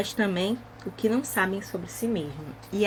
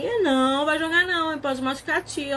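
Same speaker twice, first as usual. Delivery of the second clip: put-on higher, louder voice for a baby; teacher telling a small child not to throw things, higher and louder; talking to toddler; 6 semitones higher, 3 dB louder than usual. Shown as a demonstration. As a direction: gently scold a baby who throws things. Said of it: "Eu falo assim".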